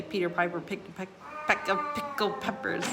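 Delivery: tongue twister tried at speed; fast tongue twister. Shown as a demonstration. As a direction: attempt a quick tongue twister. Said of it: "try to read them as fast as you can".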